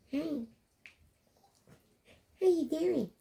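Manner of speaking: high squeaky mock greeting; squeaky man voice. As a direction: squeak out a cheesy hello. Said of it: "And then he comes to you".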